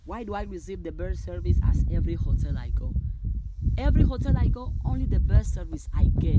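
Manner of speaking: loud, rising excitement; exultant affirmation. Real, not acted. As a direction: proclaim it loudly in amazement. Exuberant affirmations called out.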